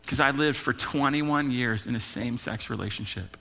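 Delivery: low, soft, halting retelling of a tearful confession; holding back tears. Retold or imitated. Pastor retelling a woman's confession after service, holding back tears. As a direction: imitate restrained tears, quietly, with long pauses. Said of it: "tears, holding back".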